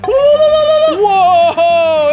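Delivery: loud, high amazed whoa; surprised whoa. Real, not acted. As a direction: gasp out a big whoa. Hot dog comes to life.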